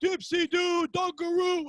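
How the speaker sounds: high-pitched announcer catchphrase; Dick Vitale. Retold or imitated. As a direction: imitate a sportscaster's excited call. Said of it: "was Dick Vitale's thing".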